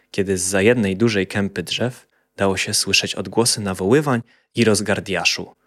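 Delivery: ordinary delivery, nothing marked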